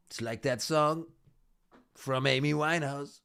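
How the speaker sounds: lightly voiced Donald Trump refusing; Trump impression. Doing Trump refusing to release the files, light impression. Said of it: "best Trump impression I can do".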